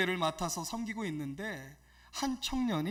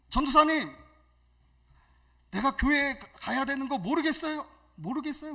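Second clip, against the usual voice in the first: higher, indignant mock outburst; imitated angry young man; 6 semitones higher, 6 dB louder than usual. Young church member snapping at his evangelist, imitated anger. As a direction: act out his heated phone protest. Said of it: "막 화를 내는 거예요".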